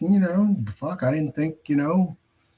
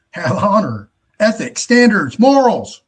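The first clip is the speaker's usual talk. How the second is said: each word louder and higher, climbing to a peak; building emphasis; 7 semitones higher, 9 dB louder than usual. Real, not acted. Ranting about scammers, building loud and high. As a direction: pile up the virtues, building to a near-shout. Rising list of virtues.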